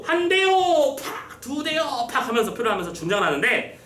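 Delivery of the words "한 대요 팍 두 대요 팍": high, sustained flogging count with smack noises; calling out strokes. Acted. Flogger counts blows for the official, calling out. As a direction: chant each count high, then a quick whack.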